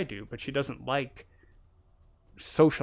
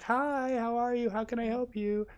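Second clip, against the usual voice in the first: way up high, sugary shop-clerk greeting; fake-cheery service voice; 11 semitones higher than usual. Mocking North American service workers with fake cheer. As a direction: chirp it like a fake-friendly cashier. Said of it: "the whole service industry".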